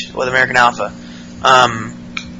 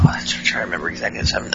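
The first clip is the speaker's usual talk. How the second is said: muttering to yourself while recalling; whispered aside; 10 semitones lower, 15 dB quieter, much faster than usual. Real, not acted. Narrator whispering to himself trying to remember.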